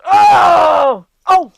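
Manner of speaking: high, loud hateful cry; furious outburst. Acted. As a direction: rage about the enemy. Lady raging at enemy.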